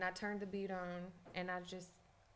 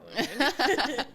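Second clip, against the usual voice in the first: laughing; 6 semitones higher, 10 dB louder than usual. Real, not acted. Laughs at missed trivia.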